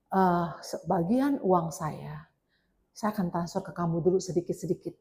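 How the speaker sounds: soft, lightly voiced suitor; quoted scammer. Pastor retelling a scammer's money promise, soft imitation. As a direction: softly imitate a smooth online suitor. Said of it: "Terus dia bilang ini".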